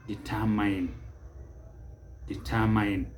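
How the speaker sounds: determine as di-tur-MINE; mispronunciation. Shown as a demonstration. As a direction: end determine on a drawn "mine". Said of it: "it is never determine".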